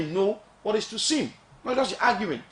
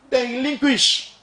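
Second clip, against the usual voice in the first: slow, high, louder on relinquish; emphatic; 7 semitones higher, 6 dB louder, slower than usual. Real, not acted. Making the point to the audience, a slow emphatic stress. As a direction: lean hard on each syllable.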